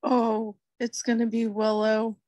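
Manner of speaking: ordinary talk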